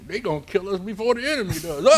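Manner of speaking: muttered wisecrack, high and fast; side of mouth. Retold or imitated. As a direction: smart aleck aside from the mouth's side. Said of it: "I'm talking out of the side of my mouth".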